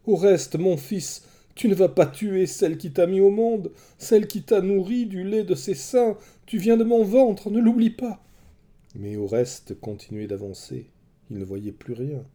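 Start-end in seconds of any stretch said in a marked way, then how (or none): 0.1-8.2 high, loud pleading scream; a mother crying out for her life; desperate cry. A narrator's reading. Terrified mother crying out to her armed son, high and loud. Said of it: "Elle cria".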